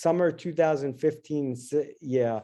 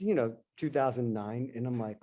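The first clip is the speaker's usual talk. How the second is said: ordinary talk, nothing marked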